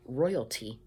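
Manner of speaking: ordinary delivery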